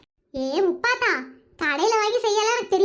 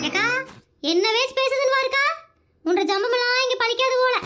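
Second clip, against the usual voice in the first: usual speaking voice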